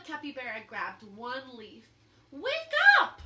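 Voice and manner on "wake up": much higher, much louder yell. A narrator's reading. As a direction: yell at the leaf to wake up. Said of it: "she yelled".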